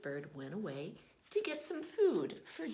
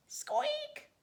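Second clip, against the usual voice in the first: very high, sharp squeak with a rising tune; high chick squeak from inside the shell; loud squeak; 4 dB louder than usual. Acted. Imitating the egg squeaking.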